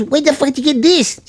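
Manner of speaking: high-pitched incredulous challenge; mock angry demand. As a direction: imitate an irate boyfriend demanding an explanation. Acted boyfriend challenges her about TV.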